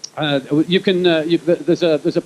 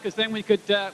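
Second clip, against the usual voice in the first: usual speaking voice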